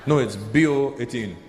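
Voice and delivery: low, firm correction; falling, matter-of-fact correction; correcting. Acted. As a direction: state it as a settled fact. Friend corrects the confused comedian.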